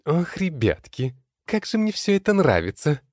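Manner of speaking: very high squealing delight; gleeful squeal. Acted. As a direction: squeal with glee. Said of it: "Верещит от радости лень".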